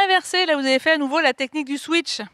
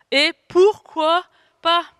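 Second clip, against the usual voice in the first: higher, louder and slow, full of defiance; slow, defiant answer to the inner saboteur; defiant comeback; 4 semitones higher, 5 dB louder, much slower than usual. Shown as a demonstration. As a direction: throw it back proudly at the doubter.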